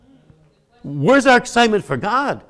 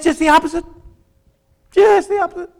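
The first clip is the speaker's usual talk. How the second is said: amused emphasis; 11 semitones higher, faster than usual. Real, not acted. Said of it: "my wife".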